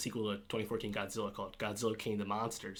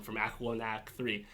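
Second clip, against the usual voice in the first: usual speaking voice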